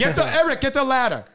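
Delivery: imitated bossy father. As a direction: order someone about like an impatient dad. Father ordering Eric about.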